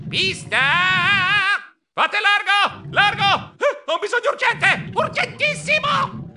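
very high, loud panic; urgent shouting. Acted. Mouse rushing to the bathroom, frantic high shouting. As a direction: clear the way with frantic yells.

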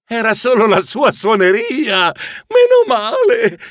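high-pitched burst of relief; relieved. A narrator's reading. Narrator learning it was just a ringtone, relieved. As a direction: let the relief leap up high. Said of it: "Io commentai sollevato".

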